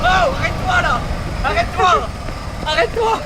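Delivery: high, urgent call to stop; urgent shout. Real, not acted. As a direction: yell at them to stop.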